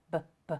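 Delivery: "b, b" as two short buh sounds; "b, b" as bare starting sounds, not the letter name; isolated B sound. Shown as a demonstration. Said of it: "the B sound".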